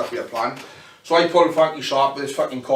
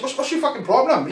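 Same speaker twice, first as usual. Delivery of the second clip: retold snap; 10 semitones higher than usual. He snaps back at K-Man.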